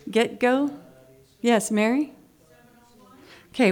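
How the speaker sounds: normal talking voice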